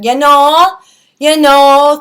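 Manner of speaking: loud, high, exaggerated Minnesota accent; Michelle Bachmann. Imitating a politician from comedy sketches, hammed-up Minnesota accent. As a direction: stretch the vowels in a mock Minnesota accent. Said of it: "Michelle Bachmann, like, has that".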